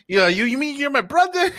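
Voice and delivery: put-on high, laughing goofy Brooklyn accent; laughing Brooklyn send-up; mock Brooklyn Snake. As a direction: ham up Snake's new Brooklyn voice. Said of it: "Liquid has the same accent".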